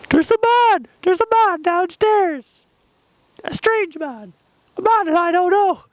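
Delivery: very high, loud squealing mock panic; panicked alarm. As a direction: raise the alarm in a hammed-up high shriek. Alarming a housemate about an intruder.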